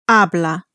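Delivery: "Habla" stressed on its first syllable. Shown as a demonstration. Tutor shows the changed stress, "Habla" stressed on the first syllable. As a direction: hit "Ha" in "Habla", let "bla" fall away. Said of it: "So the stress has changed".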